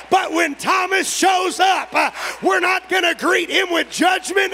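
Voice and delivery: high, loud prophetic preaching; prophesy over the room at full voice; preaching at full force. Acted. Preacher prophesying Thomases will come back, full-force preaching.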